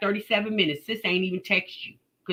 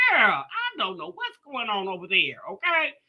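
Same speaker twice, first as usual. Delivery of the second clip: usual speaking voice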